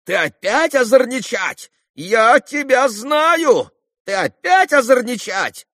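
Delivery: very high, loud, stretched accusations; agitated shouting. A narrator's reading. Townsman accusing Kolya of mischief, shouting. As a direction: keep yelling in foolish agitation. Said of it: "закричал мещанин".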